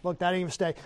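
ordinary talk, nothing marked

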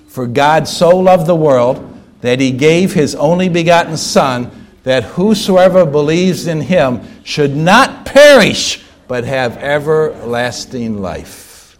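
low, measured scripture recitation; slow recitation. Real, not acted. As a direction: say it slowly so each phrase can be pondered. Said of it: "let's say it slowly".

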